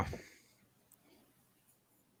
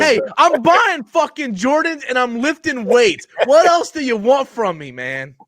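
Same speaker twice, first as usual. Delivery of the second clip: mock outrage; 10 semitones higher than usual. Mock defensive whining rant.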